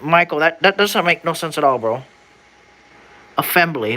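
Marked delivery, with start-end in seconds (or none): 3.4-4.0 "Assembly" read as "afembly", an F for the S; long-s misreading. Shown as a demonstration. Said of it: "Why are they putting Fs where it should be assembly?".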